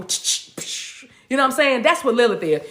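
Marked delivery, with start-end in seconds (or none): 0.0-1.1 sharp unvoiced tch and psh noises; mouth-made sound effects of drawing back and striking; imitated whooshing strikes. Speaker showing how Lilith draws back, imitated whoosh. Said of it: "draw back and go".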